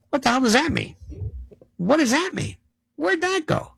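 high, questioning pitch; mock bewilderment. Questioning a slogan to the audience, mock bewildered. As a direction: ask it high, as if baffled.

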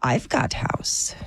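slow, low, put-on Eastern European accent; fake Eastern European accent. Would-be home scalper pitching for fun, low mock Eastern European accent. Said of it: "Is this Eastern European?".

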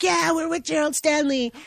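retold cry from an angry crowd; mob-like. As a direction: mimic someone rallying a mob. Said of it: "it turned very mob-like".

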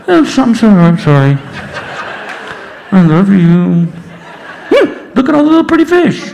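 loud, muffled snorkel voice; talking through a snorkel. Acted. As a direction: honk the words through a mouthpiece. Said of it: "talking to her through the snorkel".

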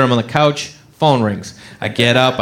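normal talking voice

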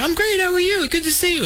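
high, chummy reply; retold effusive greeting. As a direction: imitate two pals greeting each other.

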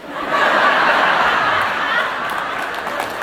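high, unvoiced embarrassed laugh; breathy laugh. Real, not acted.

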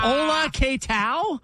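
mock Spanish accent. Playful mock Spanish greetings.

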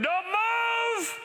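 very high, stretched-out screamed protest; mock scream. Child yelling at parents to wait for the buckle, mock scream. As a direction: scream it like a panicking child in the car. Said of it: "They start screaming".

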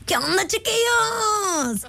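high, slow, stretched cartoon-boy greeting; Beto. Shown as a demonstration. Host discovering she can do Beto, high and slow. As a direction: drag out chiquillos like a cartoon kid. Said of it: "sí me sale la de Beto".

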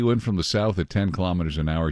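ordinary talk, nothing marked

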